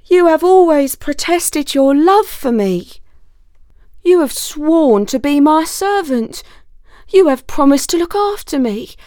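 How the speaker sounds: pleading. Acted. Wolf reminding the fox of his vows, pleading. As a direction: beg him to remember his promises. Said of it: "pleaded the wolf".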